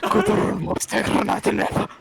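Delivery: German-language line in a put-on accent; mock stern German. Shown as a demonstration. Showing off German to the table, scary mock accent. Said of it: "That's scary".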